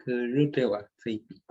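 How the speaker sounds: "Rewa feipi" said clearly as a Mapudungun model for the class; Mapudungun model phrase. Shown as a demonstration. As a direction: read "Rewa feipi" out as a native model for students to repeat. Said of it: "Mapudungun meu".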